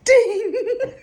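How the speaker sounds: a sudden, very high, loud "Ding!" like a bell. Retold or imitated. Remembering old pain, she rings out a loud high bell sound. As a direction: ring out "Ding!" as a big high bell chime. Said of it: "And I just go".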